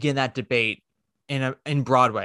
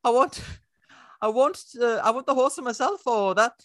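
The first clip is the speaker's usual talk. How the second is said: high falsetto pleading, laughing at the start; Monty Python son; 10 semitones higher, 3 dB louder than usual. Acted. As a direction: ham up the whiny son again. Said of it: "I need to do my Monty Python thing".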